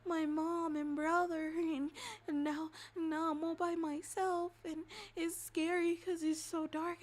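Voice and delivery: put-on high, slow childlike whimper; scared little girl. Lost child tells rescuer she's alone in the dark, whimpering. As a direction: play a small frightened child, halting and high. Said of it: "and she's like".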